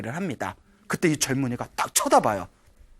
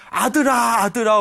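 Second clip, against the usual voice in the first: mock wailing; 6 semitones higher, 5 dB louder than usual. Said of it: "울면서 한탄을 하고 있더래요".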